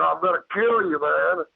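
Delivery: high-pitched re-enacted threat; retold threat. Retold threat to creature in tree.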